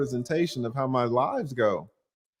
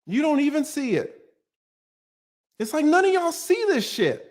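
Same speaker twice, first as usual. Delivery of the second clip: much higher, pained exasperation; exasperated rage; 10 semitones higher than usual. Real, not acted. Streamer raging at chat, exasperated. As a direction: cry out in disbelief.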